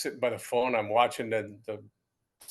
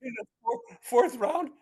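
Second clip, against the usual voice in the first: high-pitched incredulous outburst; hammed-up astonishment, voice way up; retold disbelief; 11 semitones higher than usual. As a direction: balk at the news in a high voice. Disbelief at his draft round.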